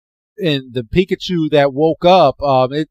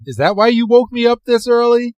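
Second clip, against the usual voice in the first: groggy annoyed; 9 semitones higher than usual. Retold or imitated. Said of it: "And he's like".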